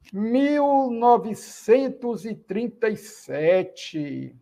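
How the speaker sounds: slow, careful number reading. Shown as a demonstration. Teacher reading a number slowly to students. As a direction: read the number out slowly for class. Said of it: "vamos lá".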